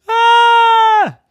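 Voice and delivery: loud high-pitched scream. A narrator's reading. Rose sees her jack-o'-lantern, screaming. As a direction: let out a high, sustained shriek. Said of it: "then she screamed".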